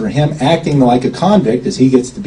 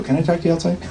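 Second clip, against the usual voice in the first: softer, a little higher, pulled aside; discreet aside; 4 semitones higher, 7 dB quieter, much faster than usual. Retold or imitated. Pulling the friend aside at the signing, hushed request. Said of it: "So I go".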